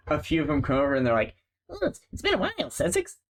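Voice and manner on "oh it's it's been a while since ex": much higher, fast squeaky rat voice; little rat voice. Acted. GM voicing the rats greeting Sage, tiny squeaky voice. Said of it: "like, little rat noises".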